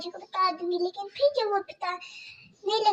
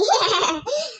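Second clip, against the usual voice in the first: gloating laugh; 3 semitones higher, 4 dB louder than usual. Acted. Stepmother gloats.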